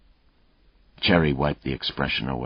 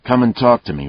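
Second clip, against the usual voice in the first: calling louder over a running mower; over the engine noise; 8 semitones higher, 9 dB louder, faster than usual. A narrator's reading. Detective to a suspect mowing his lawn, calling over the engine. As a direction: raise it to carry over the engine. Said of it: "over the engine noise".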